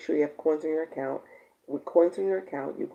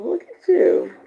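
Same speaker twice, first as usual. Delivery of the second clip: high delighted look at you; 13 semitones higher, 7 dB louder, slower than usual. Real, not acted. Host delighting in a viewer on vacation, high and playful.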